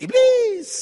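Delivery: very high, loud exclamation; high-pitched one-word yell; Papuan congregation's answer. Acted. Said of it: "orang Papua ngomong".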